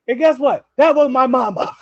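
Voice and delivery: high and loud, laughing through it; laughing punchline. Retold or imitated. [name] telling how he walked into his mom, laughing. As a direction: deliver the reveal while cracking up.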